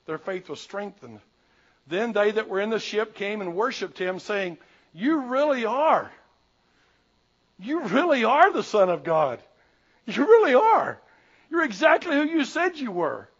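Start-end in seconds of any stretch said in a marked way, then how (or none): 4.9-13.3 loud, high exclamation of conviction; awed worship. Acted. Disciples worshipping Jesus after the storm, awed and emphatic. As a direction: proclaim it with astonished certainty.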